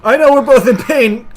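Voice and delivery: high, loud urgent pleading; worked-up high-pitched cries; desperate shouting. Real, not acted. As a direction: yell through the struggle, frantic. Desperate struggle in game.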